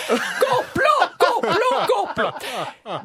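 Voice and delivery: crie complot, beaucoup plus aigu et fort, en riant; un cri d'alarme moqueur qui retombe; mock alarm. Character cries conspiracy, laughing. (dame paniquée qui crie au complot en riant)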